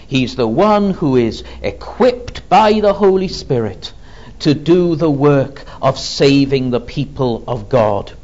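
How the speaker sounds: ordinary delivery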